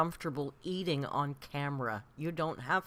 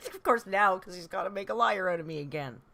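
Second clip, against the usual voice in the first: laughing, a bit louder and faster; 4 dB louder than usual. Real, not acted. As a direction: laugh through the words at the cat. Cat meows on cue.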